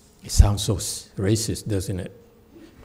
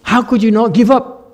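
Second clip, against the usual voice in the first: higher and louder, pressing for the answer; eager interviewer; 9 semitones higher, 6 dB louder, faster than usual. Retold or imitated. An interviewer presses the old man, eager and higher. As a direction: lean in and demand the secret. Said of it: "so the interviewer said".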